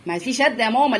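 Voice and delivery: ordinary delivery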